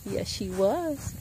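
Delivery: slow, soft cooing. Real, not acted. Baby talk about the goat.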